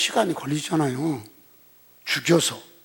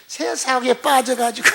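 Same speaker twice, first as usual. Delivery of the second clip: chuckling; 6 semitones higher than usual. Real, not acted. Preacher chuckles while mocking worldly believers.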